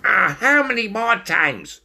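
fed-up high-pitched yell; exasperated stoat scream. A narrator's reading. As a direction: cry out in squeaky exasperation. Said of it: "screamed Stoat".

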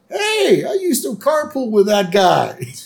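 high-pitched imitated boy calling out; excited kid. Boy in the crowd bragging about a carpool, excited. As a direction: pipe up high and proud. Voicing Milhouse boasting to crowd.

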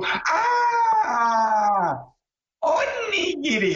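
long high mock shout of sudden understanding; dawning realisation. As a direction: stretch it out high, as the penny drops. Waitress suddenly understands the order.

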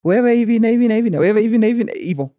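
put-on a child announcing her report loudly to the church. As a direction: rattle it off loud and quick like a bold child. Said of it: "I said it loudly".

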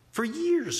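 for years jumped high and punched; emphatic for years. Real, not acted. Stressing how long grandma couldn't cook, high exclamation. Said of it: "she hasn't been able to cook for years".

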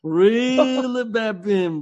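long drawn-out REEL, pitched high; slow, stretched holler; mock fisherman's call. Repeats the mock fishing call.

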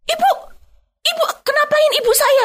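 very high-pitched accusing quote; retold angry caller. Daughter on the phone accusing the interviewer, high retold scolding. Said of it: "Dimaki sama orang yang ngangkat telepon itu".

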